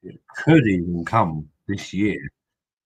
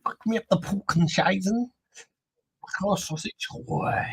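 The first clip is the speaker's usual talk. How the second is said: much higher mock German accent, hammed up; 13 semitones higher than usual. Joking to stream viewers as a German guy, mock German accent. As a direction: play a crude German guy in a put-on German accent. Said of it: "Almost sounded like I was a German guy".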